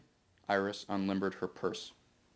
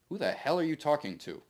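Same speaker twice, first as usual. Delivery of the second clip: snapping; 6 semitones higher, 3 dB louder than usual. Acted. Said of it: "Maxwell snapped".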